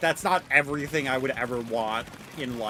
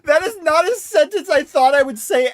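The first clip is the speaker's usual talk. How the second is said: real exasperated yelling; 8 semitones higher, 11 dB louder than usual. Real, not acted. Streamer reacting to chat, loud high outburst. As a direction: let it burst out, half laughing. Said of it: "I'm going to scream".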